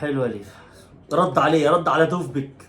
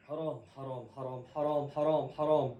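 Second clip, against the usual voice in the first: dismayed murmur; 5 semitones lower, 11 dB quieter than usual. Real, not acted. Dismay after miss.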